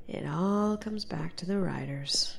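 hushed ominous whisper. Acted. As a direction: let it land low and secret. Ominous private conclusion.

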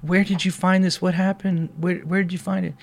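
high-pitched voice of an overwhelmed woman; acted weepy amazement, high; imitated tearful disbelief. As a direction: imitate someone asking through tears, pitched high. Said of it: "crying, and she's like".

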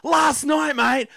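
high, loud, very slow shouted boast; thrilled backpacker stretching out the story; high and loud, every word drawn out; excited Aussie bloke. Retold or imitated. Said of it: "He goes".

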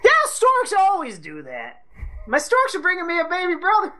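put-on high, fast child voice; lightly voiced little kid; mock child. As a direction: gush like an excited kid.

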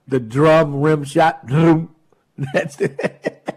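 usual speaking voice

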